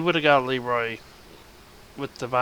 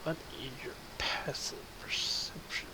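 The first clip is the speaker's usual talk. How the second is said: quiet secret whisper; 11 dB quieter than usual. Real, not acted. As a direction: whisper the question privately. DM's secret check question.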